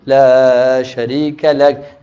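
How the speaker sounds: slow, chanted prayer recitation; talbiyah recitation. Shown as a demonstration. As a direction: recite the talbiyah slowly, leading the room. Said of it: "You wanna read it with me?".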